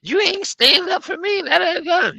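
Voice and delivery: hammed-up falsetto grievance; mimicked whining customer. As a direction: imitate a customer berating a boyfriend.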